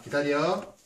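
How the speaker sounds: loud, sharp wait order; firm dog commands. Real, not acted. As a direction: snap the command out.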